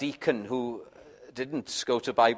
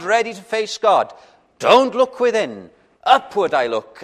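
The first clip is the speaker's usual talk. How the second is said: louder, higher pleading preacher's voice; impassioned appeal from the pulpit; fervent preaching; 6 semitones higher, 7 dB louder than usual. Acted. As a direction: press the question home to the congregation. Preacher rises to full force.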